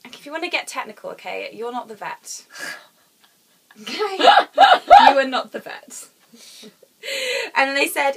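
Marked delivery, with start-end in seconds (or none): 3.8-6.1 high, loud, slow insistence; loud mock scolding. Real, not acted. To the friend correcting her, a loud slow teasing scold. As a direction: drag it out in a high mock yell.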